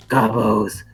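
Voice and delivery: slow, breath-heavy shaman oration; rally the tribe in a slow, solemn rasp; Gabo shaman. Acted. Shaman rallies his betrayed people, slow solemn whispery voice. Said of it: "Shaman Waka".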